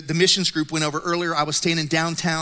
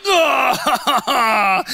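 dismayed groan; 7 semitones higher, 6 dB louder than usual. Retold or imitated. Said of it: "And I'm like".

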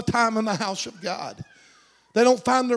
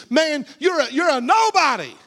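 accusing inner voice; 6 semitones higher than usual. Acted. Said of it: "One side of him was saying".

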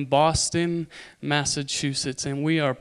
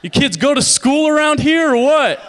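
high, loud exasperated jab; loud, incredulous comic outburst; mock exasperation; 11 semitones higher, 9 dB louder than usual. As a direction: demand it in comic disbelief. Mock-reacts to Boston cousin's English.

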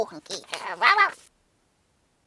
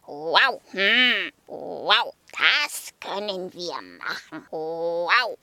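low woofing dog; 7 semitones lower, slower than usual. Acted. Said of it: "legte Sokrates den Kopf schief".

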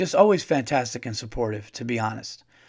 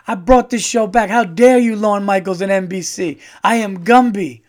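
loud, high mock indignation; imitating an outraged Gumby; 5 semitones higher, 7 dB louder than usual. Gumby scolding Lorne Michaels and NBC, loud mock rage. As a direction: rant like a diva claiming credit for the show. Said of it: "and then he's like".

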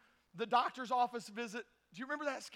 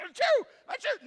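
coughs breaking into high, loud sneezes; hammed-up comic sickness; fake sneezing fit; 10 semitones higher, 8 dB louder than usual. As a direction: hack and sneeze like you're dying. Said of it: "this guy's dying up here".

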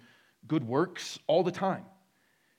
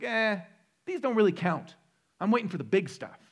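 dismissive; 6 semitones higher, 5 dB louder than usual. Retold or imitated. Voicing dismissive inner excuse.

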